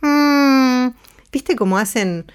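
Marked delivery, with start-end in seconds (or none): 0.0-0.9 high, held hum; humming timbre demo. Shown as a demonstration. Voice teacher shows what timbre is, high sustained hum. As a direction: hum a buzzing, steady "mm" high up. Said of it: "Este es el timbre de la voz".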